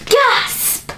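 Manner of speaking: sharp high shocked gasp. A narrator's reading. Amelia discovers Norman is a bear, shocked gasp.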